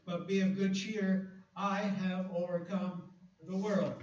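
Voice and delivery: triumphant scripture read. Acted. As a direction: proclaim it slowly with confidence. Scripture reading.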